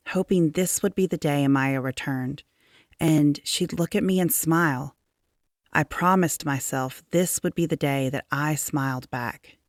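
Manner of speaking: usual speaking voice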